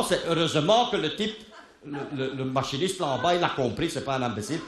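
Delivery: ordinary talk, nothing marked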